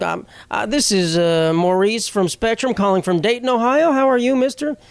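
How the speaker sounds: hesitant mock phone rep, climbing high at the end; call-center rep. Rep from Ohio greeting a customer, hesitant mock phone voice. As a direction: imitate a halting scripted greeting that lifts into a high question. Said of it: "they tell you where they're from".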